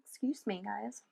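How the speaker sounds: hoarse after a frog in the throat; soft and husky, throat catching. Real, not acted. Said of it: "I got a frog in my throat".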